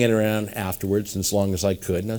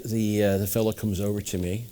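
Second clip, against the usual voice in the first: usual speaking voice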